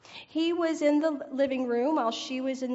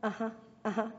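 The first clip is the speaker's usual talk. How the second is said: absent uh-huh; 7 dB quieter, much faster than usual. Retold or imitated. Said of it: "just going".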